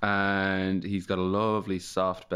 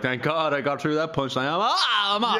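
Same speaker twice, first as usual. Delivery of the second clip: relieved firefighter comic; 6 semitones higher than usual. Retold or imitated. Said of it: "And he's like".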